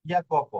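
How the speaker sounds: Italian j as y in ইয়াকোপ; Y pronunciation. Shown as a demonstration. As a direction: say ইয়াকোপ with y as in "yard". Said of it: "কিন্তু এটা হয়েছে কি?".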